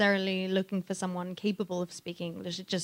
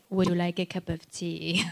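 British accent; 2 semitones lower than usual. Shown as a demonstration. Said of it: "but otherwise".

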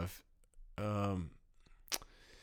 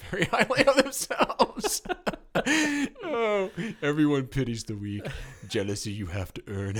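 laughing; 9 semitones higher than usual. Real, not acted. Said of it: "repeat of quote".

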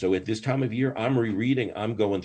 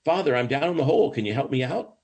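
raised, higher-pitched plea for help; acted call for help, moderately raised; shouting up; 7 semitones higher, 4 dB louder, faster than usual. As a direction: call up to a priest from down a hole. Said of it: "the guy shouts up".

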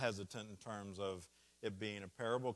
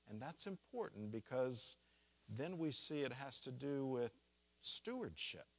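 ordinary delivery

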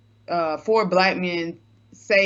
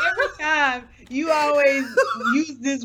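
laughing protest; 8 semitones higher, 4 dB louder than usual. Real, not acted. Laughing while protesting her voice.

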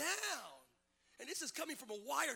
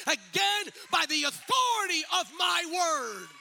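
building from strong to shouted, higher and louder; preaching at full force; 4 semitones higher, 10 dB louder than usual. Acted. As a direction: hammer each line home to the congregation.

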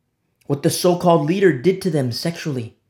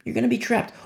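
ordinary talk

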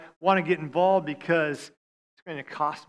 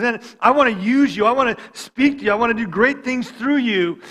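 voicing God's call; 5 semitones higher, 7 dB louder than usual. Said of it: "He goes".

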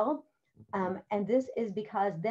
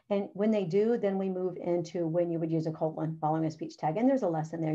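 usual speaking voice

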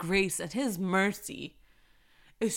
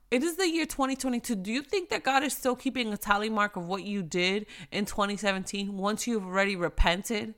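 ordinary delivery

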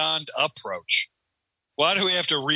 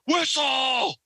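high, angry coach's yell; pissed-off scream; 10 semitones higher than usual. Retold or imitated. Pissed coach stopping a dangerous play, screaming. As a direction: imitate a furious coach halting play. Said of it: "or they're fucking pissed".

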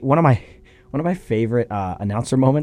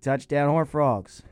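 slow, softer touchdown call; unenthused announcer call; 5 dB quieter, slower than usual. Retold or imitated. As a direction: mimic an announcer underwhelmed by the score. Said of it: "anytime T C U scored".

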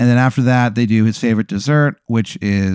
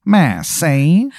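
cactus calling; 5 semitones higher than usual. Retold or imitated. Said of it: "and he goes".

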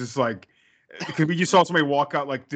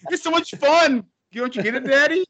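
put-on much higher, louder girlish squeal; bubbly daughter; 12 semitones higher, 5 dB louder than usual. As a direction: squeal it like a giddy little girl to her dad. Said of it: "the daughter's just like".

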